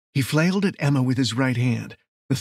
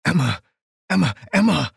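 alarmed calling; 3 semitones higher, 4 dB louder than usual. Acted. Man calling to his shot lover, increasingly alarmed. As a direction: panic building with each call.

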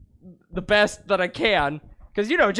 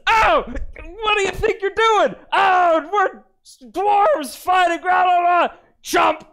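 high, loud, frantic yelling; excited shouting; 8 semitones higher, 5 dB louder, slower than usual. Acted. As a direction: yell in the middle of the brawl. Acting out a dwarf bar fight.

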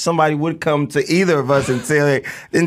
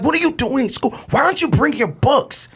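exasperated, higher-pitched scolding; intense; 8 semitones higher than usual. Retold or imitated. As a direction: interrogate your son with intensity. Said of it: "he cries at the intensity of".

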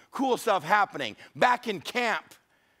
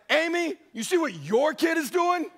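exasperated shout; 11 semitones higher than usual. Retold or imitated. Father shouting to his wife Amy about their kid. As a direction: yell it across the house, fed up.

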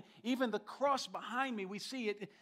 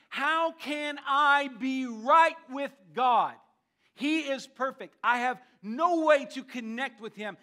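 voicing Job's plea, louder and higher, drawn out; slow, forceful mock cry to heaven; impassioned question; 4 semitones higher, 8 dB louder than usual. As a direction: plead loudly as Job, stretching the words. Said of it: "but he asks the question".

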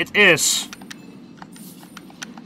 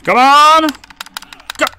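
frustrated shout; 8 semitones higher than usual. Real, not acted. Frustrated gamer yelling at game.

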